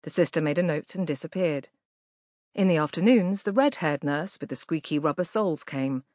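usual speaking voice

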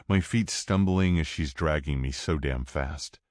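ordinary delivery, nothing marked

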